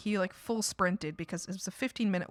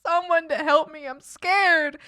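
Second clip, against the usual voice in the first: higher, louder, pleading voice; a retold call for help, higher and louder; mock scared plea; 6 semitones higher, 9 dB louder, slower than usual. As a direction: ham up a frightened cry for help. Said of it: "I was like".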